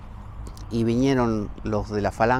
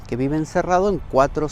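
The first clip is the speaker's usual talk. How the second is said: normal talking voice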